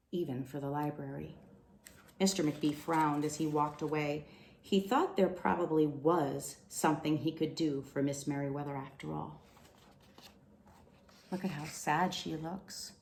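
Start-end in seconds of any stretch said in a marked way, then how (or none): none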